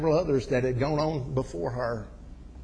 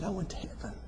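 very soft, higher retold reply; hushed deathbed reply; 7 semitones higher, 8 dB quieter, faster than usual. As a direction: imitate a frail confidence, barely voiced. Dying woman's hushed reply.

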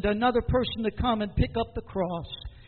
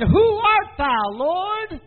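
high, loud, drawn-out question; Paul's questioning cry; 8 semitones higher, 5 dB louder, much slower than usual. Retold or imitated. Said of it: "He said".

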